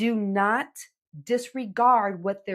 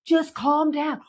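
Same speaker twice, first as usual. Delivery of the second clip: dismissive calm down; 6 semitones higher, slower than usual. Retold or imitated. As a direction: snap it at the teen. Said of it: "that phrase".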